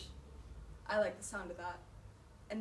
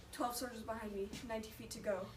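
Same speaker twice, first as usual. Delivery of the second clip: ordinary talk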